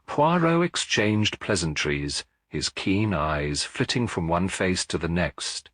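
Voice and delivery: usual speaking voice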